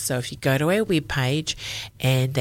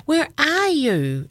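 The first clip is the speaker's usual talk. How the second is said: calling out; 10 semitones higher, slower than usual. Acted. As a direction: call out searching for someone. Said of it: "Izzy calls again".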